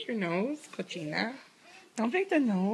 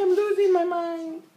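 higher mock wail over the crying; raised pretend complaint for the baby; voicing crying baby; 6 semitones higher, 4 dB louder than usual.

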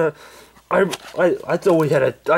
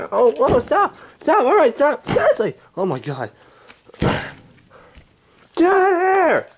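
Angry Grandpa voice; 13 semitones higher, much slower than usual. Acted. Old man woken by pranksters, high cranky yelling. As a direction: bark at the intruders like a furious grandpa.

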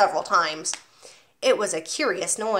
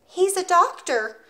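put-on 1 small boy voice; 9 semitones higher, 4 dB louder, slower than usual. Boy answers proudly.

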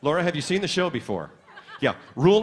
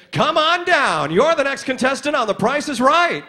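high, stretched contestant call; game show announcer; 8 semitones higher, 4 dB louder than usual. Retold or imitated. Teenager trying the announcer's job on stage, soaring announcer call. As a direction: re-enact a big announcer call. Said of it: "Call me down to contestants' row".

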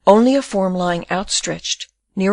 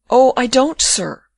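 a louder, higher, halting stammer; 5 semitones higher, 6 dB louder, slower than usual. A narrator's reading. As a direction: stumble over the words in distress. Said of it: "she stammered".

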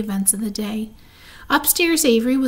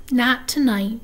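ordinary delivery, nothing marked

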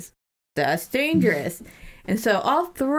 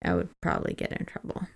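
whispered aside; 14 semitones lower, 7 dB quieter, faster than usual. Real, not acted. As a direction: drop to a near whisper. Quiet aside.

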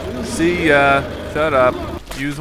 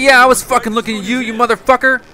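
loud, high-pitched mock rage; angry comeback; 7 semitones higher, 4 dB louder than usual. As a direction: yell back as if picking a fight. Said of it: "Sorry, I got angry".

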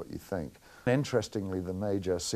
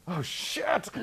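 higher startled mock exclamation; retold startled curse; shocked thought; 11 semitones higher than usual. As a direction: blurt it out in alarm, pitched up. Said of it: "And I think".

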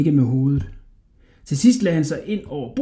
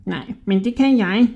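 high, squeaky, wheedling rat voice; sly little rat man; 6 semitones higher than usual. A narrator's reading. Little rat flattering a hungry Hodja, high and squeaky.

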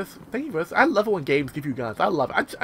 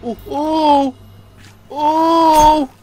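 high, loud treasure squeal; excited cooing; 9 semitones higher, 6 dB louder, much slower than usual. Real, not acted. Player spotting a treasure chest, high excited cries. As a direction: coo greedily at a find.

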